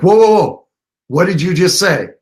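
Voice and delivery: retold eager double-take; emphatic interruption. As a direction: cut in urgently to stop someone, pitch jumping up. Acting out stopping his client mid-answer.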